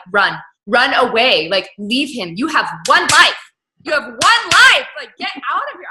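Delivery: loud, slow, pleading yell; urgent plea. Retold or imitated. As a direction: shout at the screen for her to flee. Urging the wife to flee.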